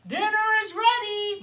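high, loud call to dinner; mama bear calling. Acted. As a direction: call the family to the table. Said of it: "Mama Bear called".

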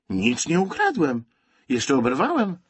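high-pitched aggrieved denial; indignant protest. A narrator's reading. Boy accused of stealing bread, indignantly protesting.

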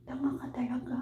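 breathy stage whisper; whispered teasing aside. Real, not acted. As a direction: drop to a whisper for the jab.

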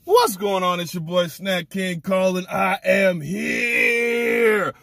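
loud, high hype greeting, last words stretched out slow; hyped intro shout. Acted. Host greeting his viewers in a loud, hyped-up shout. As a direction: fire up the viewers like a hype man.

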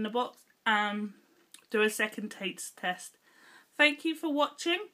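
sweet tucked in cheek; slightly thick lip movement; talking with candy in mouth. Real, not acted. Seen 3: Lopsided cheek and lip bulge during speech; she apologises for the sweet.

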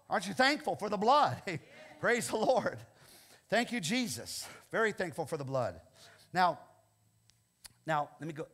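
ordinary talk, nothing marked

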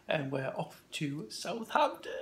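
quiet, low announcement; hushed. Real, not acted. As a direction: keep it down for the sleeping house. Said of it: "I'm being a little bit quiet".

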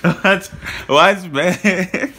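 loud laughing no; playful laughing teasing; laughing refusal. Real, not acted. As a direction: laugh it off loudly.

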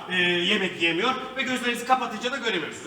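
usual speaking voice

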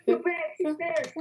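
high, alarmed cry; startled alarm. Real, not acted. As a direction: panic as he reappears. Piggy reappears.

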